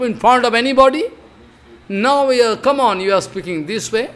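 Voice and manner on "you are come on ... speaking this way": usual speaking voice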